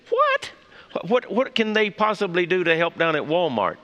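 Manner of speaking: high, disbelieving "What?", then rattled question; incredulous wife. Acted. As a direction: act out a baffled wife in a joke. Joke wife's baffled reaction to husband.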